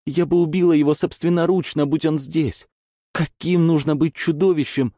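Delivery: high, loud sobbing yell; angry crying. Acted. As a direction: scream through tears in rage. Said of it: "рыдая, прокричала Элси".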